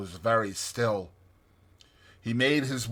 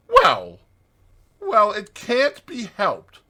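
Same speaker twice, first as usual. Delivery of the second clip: pondering; 10 semitones higher, 8 dB louder, slower than usual. A narrator's reading. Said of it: "after pondering".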